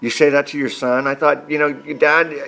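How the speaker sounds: usual speaking voice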